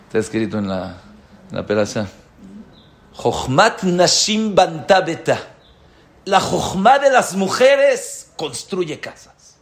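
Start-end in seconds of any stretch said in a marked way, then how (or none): 3.1-9.6 slow, loud, high chanted verse; solemn recitation. Real, not acted. Preacher intoning the verse to his congregation, slow and solemn. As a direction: proclaim the verse slowly like scripture. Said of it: "Saben cuál es la jochma".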